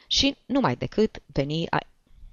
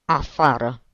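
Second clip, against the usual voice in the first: strangled, contained fury; choked voice; 4 dB louder, slower than usual. A narrator's reading. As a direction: force it out through a tight throat. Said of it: "spuse încet, cu voce sugrumată".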